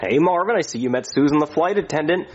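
ordinary talk, nothing marked

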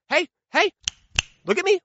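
high sing-song taunting mom voice; mom's taunt. Retold or imitated. Mom taunting misbehaving twins, high sing-song. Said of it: "she would say".

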